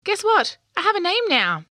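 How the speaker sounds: high, loud bursting with news; can't wait to tell; excited. A narrator's reading. Excited young unicorn news.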